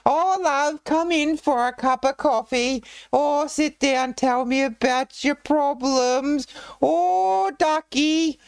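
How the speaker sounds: put-on very high shrieking old British lady voice, slowed; Monty Python woman. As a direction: squawk it like a Python housewife. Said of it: "the Monty Python women".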